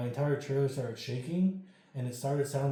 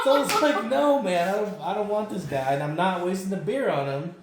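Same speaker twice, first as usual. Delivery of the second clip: laughing; 7 semitones higher, 9 dB louder than usual. Retold or imitated. Retold refusal, laughing.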